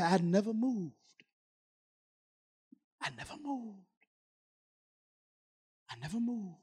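very soft, spaced-out reassurance; hushed voice of God. Retold or imitated. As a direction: drop nearly to nothing, pausing between repeats. Voices God's answer to him.

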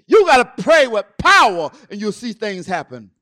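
loud, punched preacher's call; full-force preaching. Real, not acted. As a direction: urge the congregation loudly.

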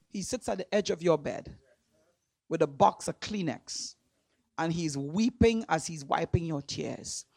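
low, soft and tender; tender, hushed. Real, not acted. Preacher picturing God at the bedside, tender and hushed. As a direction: drop low as if consoling someone in tears.

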